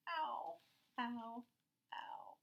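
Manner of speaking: fading cries of pain; pained ows. Acted. Boy nursing his scraped knee, fading ows. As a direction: whimper the ows down to nothing.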